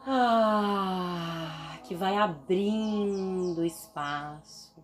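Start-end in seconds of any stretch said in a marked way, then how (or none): 0.1-1.8 long, slowly falling "aah"; tension-releasing sigh. Shown as a demonstration. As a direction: let the tension drain out on an open sound. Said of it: "solta essa tensão através deste som".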